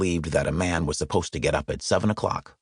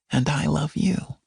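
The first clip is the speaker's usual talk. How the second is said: higher, slow, tender reply; tender bride; 8 semitones higher, slower than usual. Acted. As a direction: answer him sweetly and slowly. Bride answers husband.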